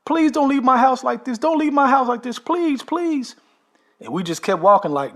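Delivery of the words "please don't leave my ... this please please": very high, louder squeaky begging; hammed-up terrified plea in a falsetto; imitating a desperate husband. Husband begs them not to leave the curse, falsetto plea. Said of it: "And he said".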